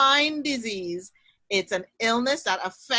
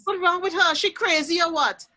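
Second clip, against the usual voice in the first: put-on higher, louder Trinidadian accent; Trini accent; 8 semitones higher, 6 dB louder than usual. Neighbour gossiping about a woman, in loud Trinidadian accent. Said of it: "I'm gonna use my Trini accent for a minute".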